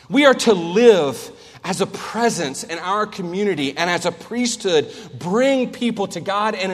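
loud, emphatic sermon cadence; preaching at full force. Acted. Preacher urging his church to serve, loud fervent preaching. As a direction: drive each point home from the pulpit.

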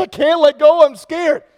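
high, loud panicked pleading; cling on in a panic, voice up high; scared kid. Retold or imitated. Scared young man on the roof begging, high and loud. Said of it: "He said".

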